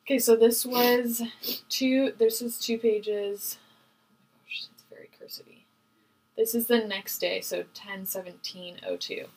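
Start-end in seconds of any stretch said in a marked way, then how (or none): 3.6-5.6 hushed whisper over the letter. Real, not acted. Opening second sealed letter.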